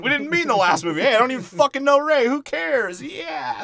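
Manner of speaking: high, loud, rushed mocking rant; mock Poe rant. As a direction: squeal it out like a clueless hotshot. Poe mocked in a he's-like quote.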